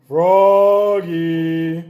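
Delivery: loud, drawn-out call; long loud shout; yelling. A narrator's reading. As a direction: yell down the hall as Dad. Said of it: "yelled Dad".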